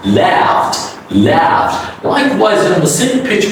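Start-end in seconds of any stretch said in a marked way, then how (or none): 0.0-2.0 'laughed' as laft, vowel held open; open-mouthed vowel. Shown as a demonstration. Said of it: "Open your mouth".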